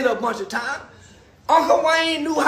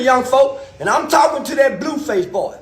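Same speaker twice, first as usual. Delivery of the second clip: ordinary delivery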